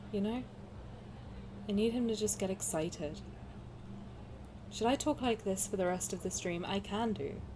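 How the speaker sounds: soft, calm, gentle voice; gentle soft-spoken. Retold or imitated. Streamer showing how soft-spoken Xavier talks, gentle and calm. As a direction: speak softly, gently and at peace. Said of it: "He's very soft spoken".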